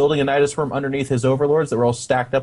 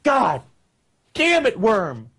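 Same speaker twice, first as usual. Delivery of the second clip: mock angry Zerg; 5 semitones higher, slower than usual. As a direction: scold the worm like a fed-up Zerg soldier. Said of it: "Zerg stepping out of it and being like".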